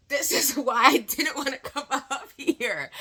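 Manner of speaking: high, slow laughing complaint; giggling mock despair. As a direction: laugh through the words at your own bad luck. Laughing while recounting the mic moment.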